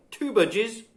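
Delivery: usual speaking voice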